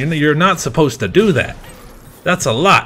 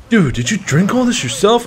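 shocked friend; 10 semitones higher, 4 dB louder than usual. Retold or imitated. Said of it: "they're like".

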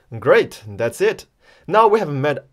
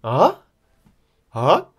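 AH like a question; short AH swept upward; rising tone; 4 dB louder than usual. Shown as a demonstration. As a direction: ask AH with a steep rise. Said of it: "Like a question".

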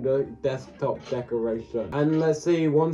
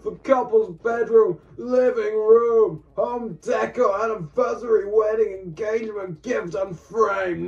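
dramatic build-up; 5 semitones higher than usual. Acted. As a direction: read the list like an epic trailer. Reads long product title dramatically.

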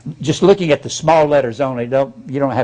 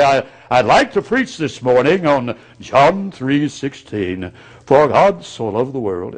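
slow, put-on pulpit tone; pompous preacher voice; 2 semitones lower than usual. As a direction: roll out big round preacher tones. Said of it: "Nobody talks like that".

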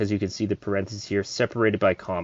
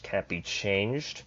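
ordinary talk

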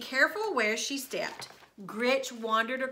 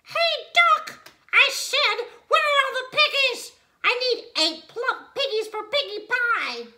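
high, loud bossy witch voice; demanding witch; 14 semitones higher, 8 dB louder than usual. A narrator's reading. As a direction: bark orders like a greedy witch. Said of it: "Gretch shouted to a duck".

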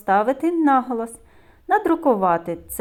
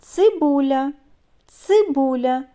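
"Цибуля" stretched, higher and louder; drawn-out word; 6 semitones higher, 5 dB louder than usual. Shown as a demonstration. Teacher helping children hear the sounds, drawn-out "Цибуля". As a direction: draw out "Цибуля" from its hard opening ц. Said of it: "Вимовляємо слова протяжно".

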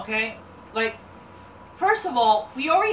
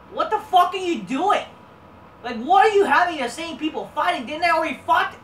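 raised, louder outburst of disbelief; fed-up shout at the screen; exasperated rant; 7 semitones higher, 7 dB louder than usual. Real, not acted. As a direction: vent at the bookers. Ranting at the show.

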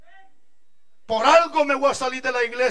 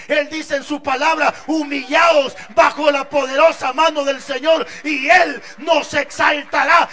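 fuerte y cada vez más alto; predicación a toda voz; 3 semitones higher, 7 dB louder than usual. Acted. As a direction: sube la fuerza frase a frase. Predicación creciente. (el predicador exalta la humildad de Cristo a gritos)

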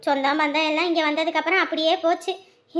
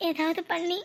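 quiet sincere confession; 6 dB quieter than usual. Acted. Woman telling her suitor why she chose him, softly sincere. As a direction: open up gently in a hushed voice.

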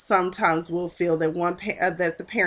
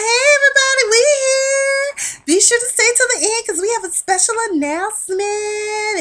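much higher, louder, showy hostess call; Camilla's announcement; 13 semitones higher, 5 dB louder than usual. Retold or imitated. Camilla announcing to the party guests, high and loud. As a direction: sing it out grandly to the whole party.

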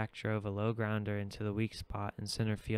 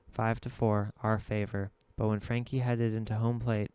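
usual speaking voice

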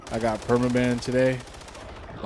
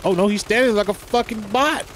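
panicked; 9 semitones higher, 5 dB louder than usual. Real, not acted. Out of ammo mid-fight.

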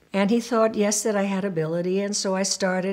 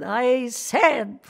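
put-on heavy German accent, breaking into gibberish; mock German teacher; 3 semitones higher than usual. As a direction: imitate an impatient German woman's garbled speech. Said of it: "a German woman".

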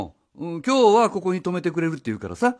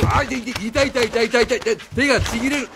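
pained yelps; 7 semitones higher than usual. Acted. Man yelps as the teacher drags his arm. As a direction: overplay the pain of being dragged. Man's arm pulled hard.

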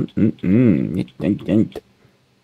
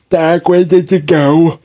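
calling out; 6 semitones higher, 4 dB louder, slower than usual. Real, not acted. Calling out to lost friend.

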